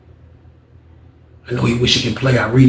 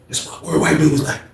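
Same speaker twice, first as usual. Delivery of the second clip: ordinary talk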